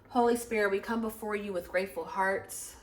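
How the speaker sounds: soft, earnest prayer voice; quiet prayer. Acted. Reader praying softly before the tarot reading.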